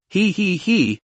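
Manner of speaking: soft, overjoyed he-he-he chuckle; delighted chuckle. Acted. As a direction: chuckle with glee, quietly. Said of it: "The boss was overjoyed".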